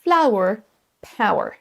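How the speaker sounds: flower, power with an open a start; A-OU-E glide. Shown as a demonstration. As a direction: say flower and power as fla-wer, pa-wer. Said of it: "trois sons qui se suivent".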